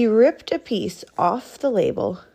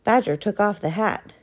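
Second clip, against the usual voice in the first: ordinary delivery, nothing marked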